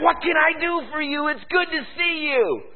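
high, hammed-up friendly greeting; big smile. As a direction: greet a visitor with eager cheer. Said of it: "I put a big smile on my face".

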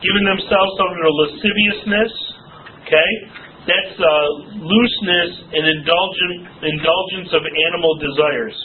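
ordinary delivery

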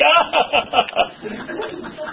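high laugh after the put-down; mock guffaw. Laughing at someone's expense, hammed-up guffaw. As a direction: cackle at your own joke.

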